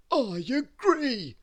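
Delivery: big, loud, drawn-out character voice; high, booming slow agreement; Mister Greedy. A narrator's reading. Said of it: "said Mister Greedy".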